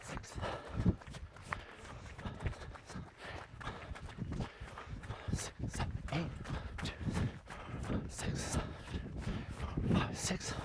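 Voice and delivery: very soft whispered rhythmic count; breathy counting. Real, not acted. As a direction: count on the breath, keeping the running cadence. Coach counting along with runner's breaths.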